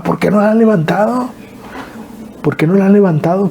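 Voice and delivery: a hint of northern bluntness; retold yelling. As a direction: echo the shout without full volume. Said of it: "yo les gritaba a mis hermanas".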